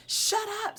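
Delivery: high shouted shut up; mock scream of a furious boy. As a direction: scream it at her. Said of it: "screamed Jeff".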